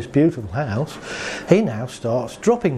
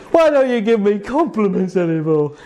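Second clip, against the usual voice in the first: whiny moan; 5 semitones higher than usual. Retold or imitated. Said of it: "And then we moan".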